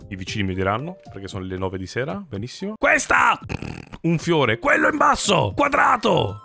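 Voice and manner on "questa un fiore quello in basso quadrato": high, loud yelled answers; shouting answers. Pretend. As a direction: shout every answer with fake grit. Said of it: "Devo urlare a ogni risposta".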